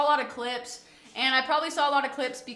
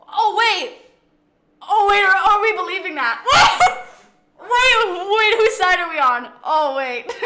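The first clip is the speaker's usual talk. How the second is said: surprised; 7 semitones higher, 11 dB louder than usual. Real, not acted. Shocked at chat news.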